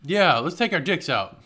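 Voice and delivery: rally the room with silly gusto; mock rowdy cheer. Host cheers on co-host's joke, mock rowdy shout.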